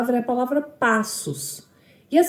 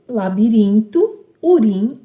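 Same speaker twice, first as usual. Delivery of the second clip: "labirinto" slow, then its stressed "rin" alone and louder; stressed syllable isolated; 6 dB louder, slower than usual. Shown as a demonstration. Teacher pointing out the strongest syllable of "labirinto", "urim" said firmly and louder. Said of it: "é a sílaba mais forte".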